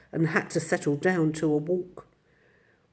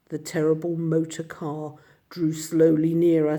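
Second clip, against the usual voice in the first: usual speaking voice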